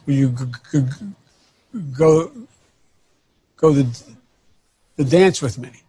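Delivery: acted stutter, catching on the g of go. Seventh grader stammering his ask to a girl, acted stutter. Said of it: "it's kinda hard when you're in seventh grade to say".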